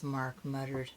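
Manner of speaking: usual speaking voice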